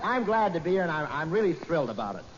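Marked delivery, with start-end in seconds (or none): none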